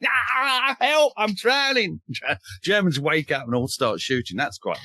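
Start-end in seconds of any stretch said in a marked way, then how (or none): 0.1-2.0 high, drawn-out cry for help; mock panicked shouting. Historian acting out a soldier fallen in the water, mock panicked shouting. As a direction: yell out like a drowning soldier, hammed up. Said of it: "starts shouting".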